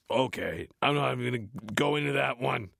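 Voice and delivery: normal talking voice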